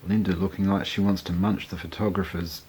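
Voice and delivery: normal talking voice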